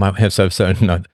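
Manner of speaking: normal talking voice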